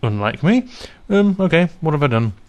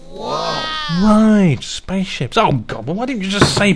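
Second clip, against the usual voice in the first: exasperated surprise; 7 semitones higher, slower than usual. Acted. Realising the guest meant spaceships all along, exasperated. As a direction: squeal it out, then snap back down.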